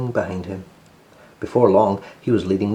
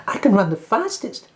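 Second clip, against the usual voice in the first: boasting rabbit brother; 11 semitones higher than usual. Acted. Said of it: "said his brother".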